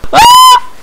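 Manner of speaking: piercing shriek; high scream. Real, not acted. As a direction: scream in panic. Sudden danger.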